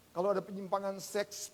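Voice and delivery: ordinary delivery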